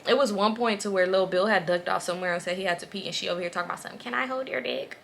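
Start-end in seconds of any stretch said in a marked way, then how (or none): 4.0-5.0 mock flirty friend. Mimicking friend's come-on.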